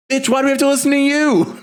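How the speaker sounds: shrill outraged shout, fast; high-pitched yell. Acted. Mock outrage at the quote.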